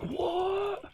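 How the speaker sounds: very high startled squeak of disbelief; a cheated kid yelping in shock. Retold or imitated. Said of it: "And he's like".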